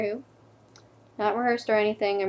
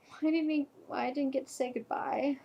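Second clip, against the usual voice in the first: pleading regret; 8 dB quieter than usual. Acted. Girlfriend who never said goodbye, pleading regret. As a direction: sink into a wounded question.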